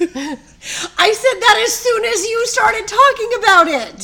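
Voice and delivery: high, slow and laughing loud. Real, not acted. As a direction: laugh through it, amazed. Laughs at the news.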